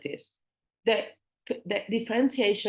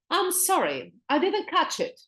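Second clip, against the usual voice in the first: polite apology, pitched up; 9 semitones higher than usual. Shown as a demonstration. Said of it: "is the other word".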